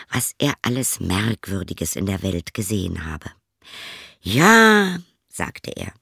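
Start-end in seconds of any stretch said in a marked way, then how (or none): 4.2-5.0 eager boast. Acted. Boasting guest at the inn.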